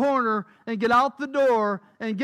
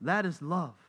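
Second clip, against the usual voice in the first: quiet; 9 semitones lower, 7 dB quieter than usual. Real, not acted. Preacher repeats key phrase softly.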